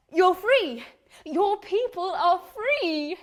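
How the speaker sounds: high, drawn-out proclamation; zealous, patronising activist; earnest insistence. Acted. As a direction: stretch it out like good news for the slow. Woman insists to stranger.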